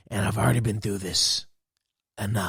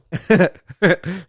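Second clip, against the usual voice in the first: laughing; 6 semitones higher, 5 dB louder than usual. Real, not acted. Laughs at own rant.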